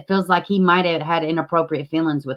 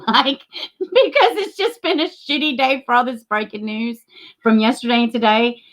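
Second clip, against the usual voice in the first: high, emotional rush of relief; real near-tearful high voice; about to cry; 4 semitones higher than usual. Real, not acted. Streamer venting to viewers after a bad news day, near tears. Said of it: "I'm about to cry now".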